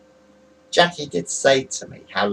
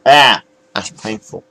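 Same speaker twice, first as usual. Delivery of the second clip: emotional pain; 2 semitones lower, 9 dB louder than usual. Real, not acted. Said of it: "I'm getting a bit emotional".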